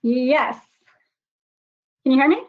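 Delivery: ordinary delivery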